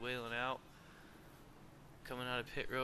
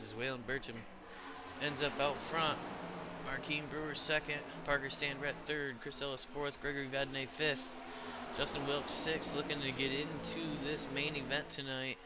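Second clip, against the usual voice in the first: live play-by-play. Acted. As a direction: rattle off the positions with energy. Calling running order.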